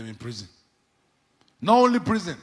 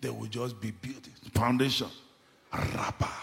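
ordinary talk, nothing marked

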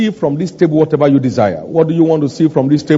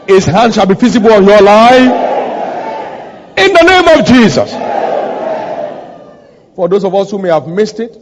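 forceful blessing, building higher and louder; commanding pulpit prayer; declarative preaching prayer; 5 semitones higher, 5 dB louder than usual. Real, not acted. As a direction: pronounce decrees over a congregation, rising to a climax. Prays decrees over the congregation.

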